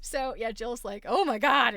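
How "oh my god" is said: loud retold exclamation of shock; mock alarm. Friend realizing the computer is listening, loud mock alarm. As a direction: exclaim it loudly, playing up the alarm.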